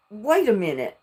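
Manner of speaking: ordinary talk, nothing marked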